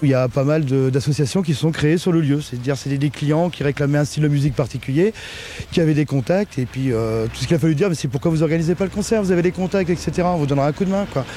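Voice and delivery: muffled archive recording. Real, not acted. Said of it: "avec cette archive sonore".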